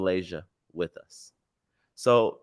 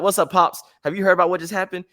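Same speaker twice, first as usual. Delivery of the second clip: higher, brighter rushed greeting; excited call; 8 semitones higher, 3 dB louder, faster than usual. Retold or imitated. Calling dad about the war news, higher and excited. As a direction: hurry the news to dad, keyed up. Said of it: "we're like".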